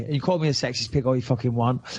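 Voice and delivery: normal talking voice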